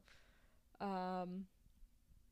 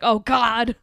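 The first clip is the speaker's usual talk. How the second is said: retold dread; 3 semitones higher, 7 dB louder than usual. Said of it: "but I was like".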